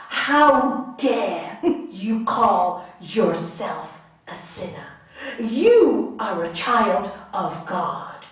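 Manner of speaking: firm indignant pronouncement; forceful rebuke. Retold or imitated. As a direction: proclaim it with great force, slowly. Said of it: "he said it in that force".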